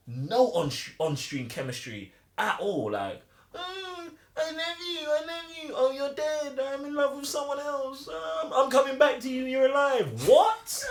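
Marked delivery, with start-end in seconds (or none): 3.5-10.1 mock lovesick lover. Mocking the film's romance. 10.3-10.9 high, shrieked disbelief with laughter; incredulous. Acted. Disbelief at the film's romance.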